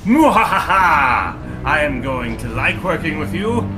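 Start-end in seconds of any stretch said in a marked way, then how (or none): 0.0-1.3 slow theatrical villain laugh; drawn-out menacing mwahaha; evil cackle. Acted.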